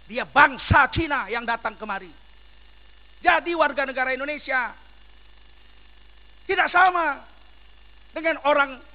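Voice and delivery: loud, emphatic sermon delivery; fiery preacher hammering each point; forceful preaching. Acted. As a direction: preach it hard to the crowd.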